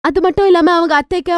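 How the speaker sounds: plain reading voice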